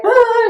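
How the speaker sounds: high sudden "ah" showing a crying face; a quick high wail to show the look; demo cry sound. Shown as a demonstration. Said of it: "like this".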